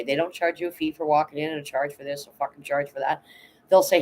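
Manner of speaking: ordinary delivery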